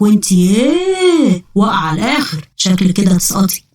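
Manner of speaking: ugly low voice with stretched-out words; Gamra's ugly frog-swallowed voice. Acted. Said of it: "صوت أفكاري بقى وحش كده ليه".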